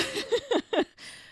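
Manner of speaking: high giggle; quick high laugh. Real, not acted. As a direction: giggle at herself. Laughing mid-sentence.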